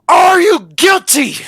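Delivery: crying, shouting high and loud; accusing shout. Acted. Reading Kyle's question as a shouted, crying accusation. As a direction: yell the accusation like a breaking sob.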